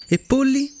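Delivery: a sudden burst of hopeful curiosity; eager curiosity. Acted. Fox eagerly quizzing the little prince about chickens, excited. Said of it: "La volpe sembrava molto incuriosita".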